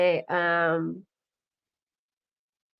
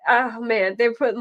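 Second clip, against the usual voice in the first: usual speaking voice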